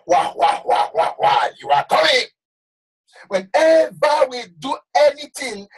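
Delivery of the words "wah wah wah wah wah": voicing hell's mocking laugh; preacher mimics hell laughing; mocking laughter. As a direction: wah wah as hell laughing at sinners. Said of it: "hell will laugh".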